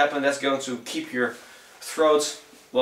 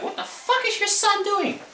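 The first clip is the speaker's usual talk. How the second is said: a much higher, shrill-edged voice for the angry neighbor; complaining neighbor. Retold or imitated. Neighbor complaining about the noise, in a much higher mock voice. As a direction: squawk it like a fed-up neighbor lady. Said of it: "if your neighbors are coming".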